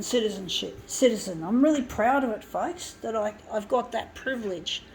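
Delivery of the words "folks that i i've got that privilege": ordinary talk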